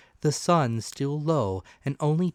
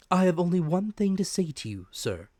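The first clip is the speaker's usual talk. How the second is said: calm steady doctor; 7 semitones higher than usual. Acted. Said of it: "perfectly calm and steady".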